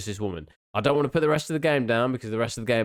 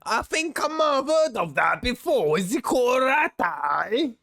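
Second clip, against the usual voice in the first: mocking accent; 9 semitones higher, slower than usual. Imitating the character's odd voice.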